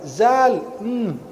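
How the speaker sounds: ZAL said as a buzzed z, then a hum; mock wrong "Zal"; mimicked mispronunciation. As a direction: imitate a learner saying "Zal" instead of dhal. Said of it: "Dia bilang apa?".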